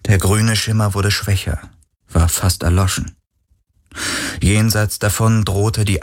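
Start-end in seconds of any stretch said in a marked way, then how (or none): none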